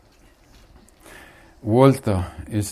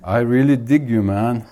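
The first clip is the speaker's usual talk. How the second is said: brash, loud, cocky shout at God; bold, loud demand to God; 7 dB louder than usual. Retold or imitated. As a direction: yell it up to heaven, full of swagger. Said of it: "and shouts".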